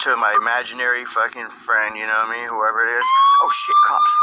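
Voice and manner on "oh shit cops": sudden high squeal of panic; mock alarm. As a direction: yelp it like the cops showed up. Mock panic about cops.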